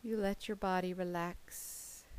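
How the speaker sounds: plain reading voice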